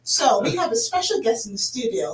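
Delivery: put-on British accent. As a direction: introduce the guest in a posh British accent, unhurried. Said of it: "Should I say in the British accent?".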